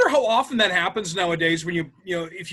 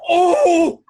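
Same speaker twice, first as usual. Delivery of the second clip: startled oh; 8 semitones higher, 8 dB louder than usual. Retold or imitated. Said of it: "and she's like".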